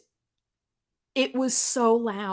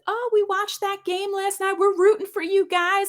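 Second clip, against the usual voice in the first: Southern lady; 13 semitones higher than usual. Retold or imitated. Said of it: "the lady sees my Yankee shirt, and she said".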